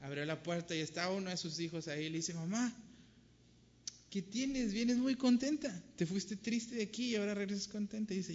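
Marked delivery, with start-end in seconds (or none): 2.3-8.1 son's voice. Acted. Son questions his mother.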